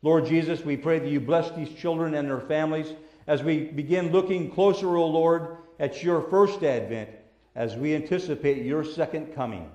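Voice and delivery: soft, reverent prayer voice. Acted. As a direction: pray quietly over the children. Closing prayer with eyes closed.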